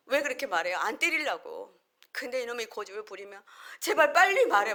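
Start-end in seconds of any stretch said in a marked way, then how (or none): 3.8-4.7 put-on high, loud desperate plea to the child; a parent near tears, crying out high and loud; pleading yell. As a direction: beg the stubborn kid to just say it.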